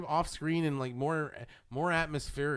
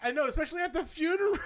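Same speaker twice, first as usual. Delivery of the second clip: laughing; 8 semitones higher, faster than usual. Real, not acted. Laughing at movie memory.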